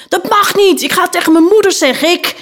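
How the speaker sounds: put-on high, fast child's outcry; protesting little boy. Boy pinned to the noticeboard threatening to tell mother, indignant outcry.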